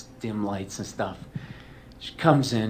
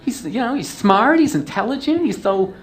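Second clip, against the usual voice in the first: high, loud mock girlfriend gushing over my dad; 13 semitones higher, 6 dB louder than usual. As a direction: play the smitten girlfriend, pitched way up.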